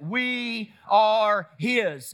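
very slow, held-out declaration; say it like you believe it. Shown as a demonstration. Preacher urging congregation to believe it, drawn-out declaration. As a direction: declare it word by word with conviction. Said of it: "Say it again like you believe it".

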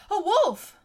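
high, loud cry of alarm; panicked cry. Acted. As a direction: yell it out, terrified. Boy panics at wolf.

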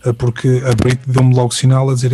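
usual speaking voice

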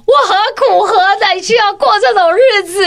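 high, loud mock-tragic complaint; exasperated lament. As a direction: wail it like a martyred mom. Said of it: "要讲说".

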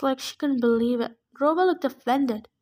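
usual speaking voice

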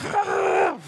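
high shouted groan of frustration; frustrated scream. Retold or imitated. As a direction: let out a high exasperated Ah. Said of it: "But your heart is like".

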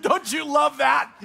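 loud, slow, high excited call; delighted shout. Real, not acted. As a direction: rouse the crowd with joy. Delighted question to congregation.

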